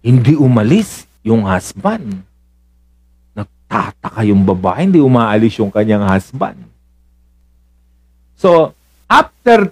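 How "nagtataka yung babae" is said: normal talking voice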